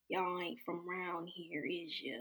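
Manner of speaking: lightly voiced slow, quiet diner lady; softly, slowly, as if sizing up outsiders; imitated diner lady. Southern diner lady questioning out-of-town customers, quiet suspicious imitation. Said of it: "this lady's like".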